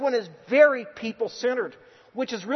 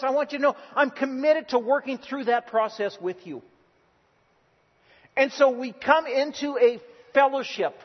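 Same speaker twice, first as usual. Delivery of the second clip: raised, higher ringing sermon voice, pressing each because; preaching at full force; 5 semitones higher, 4 dB louder than usual. Acted. Pastor urging the church to commit, preaching at full force. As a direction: preach it out to the whole congregation.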